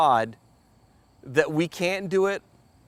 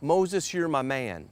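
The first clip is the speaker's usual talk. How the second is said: God speaking softly; 3 semitones lower, 6 dB quieter, slower than usual. Retold or imitated. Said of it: "And God said".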